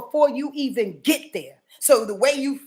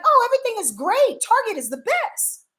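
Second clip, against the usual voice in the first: much higher, sing-song fake cheer; a high, bubbly voice pretending all is fine; mock cheerful denial; 11 semitones higher than usual. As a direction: ham up a clueless happy shopper. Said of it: "you still acting like".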